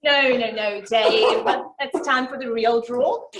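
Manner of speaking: loud, laughing call-out; laughing shout. Real, not acted. Host calls out laughing.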